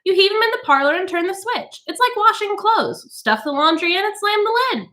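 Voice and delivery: high, chirpy and amused; tittering society woman. A narrator's reading. Mrs Bowles joking to the women about her children, tittering. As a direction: titter through it carelessly. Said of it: "Missus Bowles tittered".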